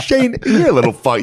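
high mock outburst at his son; dad snapping at Shane in a squeaky voice; imitating angry dad. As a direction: ham up the dad's insult. Voicing dad insulting son.

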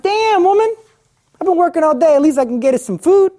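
high, loud, exasperated husband; husband complaining to his wife about dinner, high and loud; annoyed husband. Acted. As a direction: huff at your wife over no dinner.

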